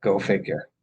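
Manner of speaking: normal talking voice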